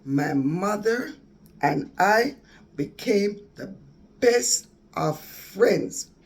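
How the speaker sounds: slow, loud, joyful emphasis. Real, not acted. Telling viewers how she and her mother reconciled, loud and slow with joy. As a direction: let the joy swell as you stretch each word.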